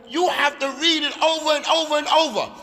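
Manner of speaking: loud, high-pitched urging; insistent exhortation. Real, not acted. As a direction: drive each repetition home at full voice. Teacher urging repeated study at home.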